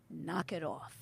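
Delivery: low, soft and firm; stern warning. Retold or imitated. Husband stopping his ranting wife, quietly stern. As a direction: shut it down quietly. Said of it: "he just gives me that look".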